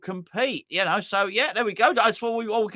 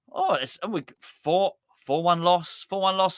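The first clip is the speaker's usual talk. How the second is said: ordinary talk, nothing marked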